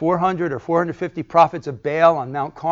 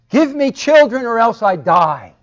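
high, loud desperate demand; emotional outburst; 9 semitones higher, 10 dB louder than usual. Retold or imitated. Rachel crying out to Jacob, loud and high. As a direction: demand it in anguish. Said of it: "Emotional outburst".

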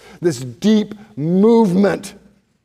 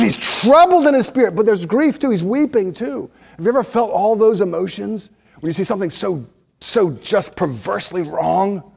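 ordinary talk

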